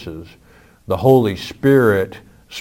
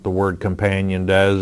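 usual speaking voice

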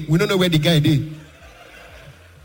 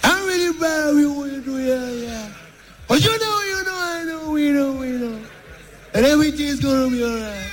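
much higher, strained American voice; hammed-up high Trump voice; Donald Trump impression; 8 semitones higher than usual. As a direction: ramble like a mock Trump. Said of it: "Donald Trump".

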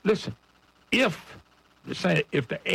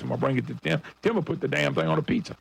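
usual speaking voice

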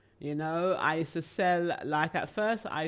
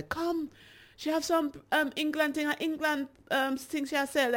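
much higher patois call to passers-by; Jamaican locals calling; 10 semitones higher than usual. Retold or imitated. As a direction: beckon people over like a market crier. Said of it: "and they would say".

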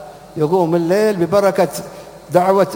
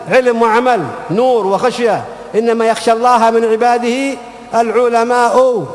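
rising preaching; 4 semitones higher, slower than usual. Acted. Preacher urging his congregation, building impassioned sermon. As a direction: drive the point home like a preacher at full force. Preaching climax on knowledge and awe.

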